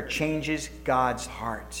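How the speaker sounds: drawn out, hushed and very slow. Real, not acted. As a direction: let each word fall slowly and softly.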